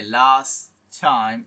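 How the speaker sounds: লাস্ট টাইম said slowly, one t; careful linked last time; slow demo of last time. Shown as a demonstration. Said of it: "এই লাস্ট এরকম হবে না".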